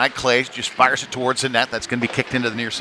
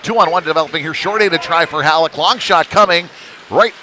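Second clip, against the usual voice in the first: higher, louder rush call; excited play-by-play; 6 semitones higher, 6 dB louder than usual. Real, not acted. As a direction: build anticipation as the attack develops. Two-on-one rush develops.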